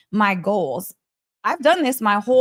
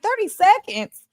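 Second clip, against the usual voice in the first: shocked; 11 semitones higher than usual. Retold or imitated. Shocked by the thirty seconds.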